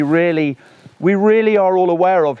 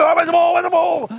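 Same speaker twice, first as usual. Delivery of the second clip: much higher shouted dog voice, frantic; frantic ball-mad dog; 13 semitones higher than usual. Pretend. Ball-crazy dog searching for its ball, high frantic shout. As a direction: yelp it out like a dog that lost the ball.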